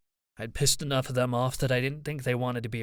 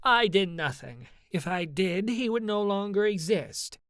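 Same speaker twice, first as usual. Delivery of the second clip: higher-pitched, scathing self-defense; 8 semitones higher than usual. A narrator's reading. Doya defends themself to an angry mother, scathing. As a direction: protest scornfully in a raised pitch. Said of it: "Doya's tone was scathing".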